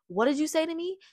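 imitating a mother's angry comeback; mock angry mom. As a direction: snap it back like an offended mom. Mom confronting her, retold quarrel.

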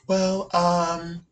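soft mock-timid mumbled impression; mocking a quiet talker. Teasing Sarah for talking like a Zoom call, mock quiet. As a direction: imitate someone who won't project, softly. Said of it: "That's how you talk right now".

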